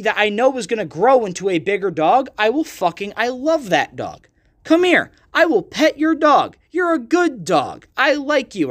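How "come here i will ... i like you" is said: talking to a dog. Acted. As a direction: coo at the puppy like it's the best dog ever. Cooing at a dog he loves.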